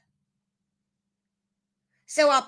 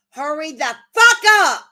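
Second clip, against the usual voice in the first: loud, higher furious shout; angry shout; 4 semitones higher, 8 dB louder than usual. Real, not acted. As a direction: yell it at the chat, fed up. Ranting at chat, repeats demand.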